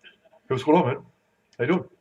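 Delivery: light impression of a buddy's greeting; Robbie. Speaker shows the audience his pal's greeting, light impersonation. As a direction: casually mimic a pal saying hello. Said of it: "That's my Robbie impersonation".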